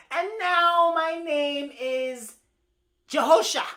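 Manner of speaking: high, loud, slowly stretched words; proclaiming bird. Acted. Bird declares new name to the others, high proclamation. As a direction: announce your new name grandly.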